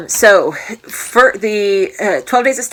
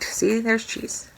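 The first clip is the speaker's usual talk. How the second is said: ordinary talk, nothing marked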